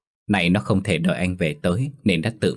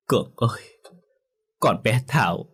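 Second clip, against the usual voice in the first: sniffling through tears; 4 semitones higher than usual. A narrator's reading. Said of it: "chỉ khóc lóc rồi sụt sịt nói".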